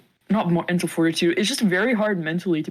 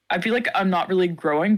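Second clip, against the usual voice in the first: normal talking voice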